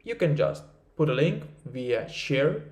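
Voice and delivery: normal talking voice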